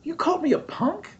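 high, squeaky outraged disbelief; mock-offended squeak at being called a punk; indignant surprise. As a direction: repeat the insult back, shocked and high. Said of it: "I didn't know the word could say punk".

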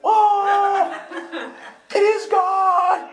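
very high, loud, drawn-out cry of revelation; mock ecstatic squeal; awestruck shout. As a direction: shriek it in hammed-up wonder. Acts out awestruck reaction in front row.

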